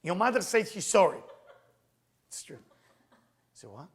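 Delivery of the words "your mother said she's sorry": high, loud rushed blurt of okay okay; nervous blurt. Retold or imitated. At the stranger's door, blurting the message high and loud. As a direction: spit it out all at once.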